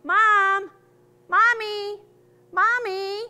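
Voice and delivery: high, loud call for mommy. Retold or imitated. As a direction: yell like a lost child looking for mom. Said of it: "if you yell out".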